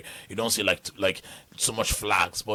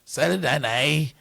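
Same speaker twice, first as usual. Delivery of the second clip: friendly, melodic Northern Irish lilt, pitched up; Northern Irish accent; 5 semitones higher, 5 dB louder than usual. Shown as a demonstration. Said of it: "the Northern accent is very, like, more like, friendly because it sounds melodical".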